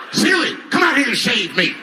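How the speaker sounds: high, slow, loud hollered order; shouted Color Purple order; mock Color Purple shout. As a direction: holler it like an old movie husband.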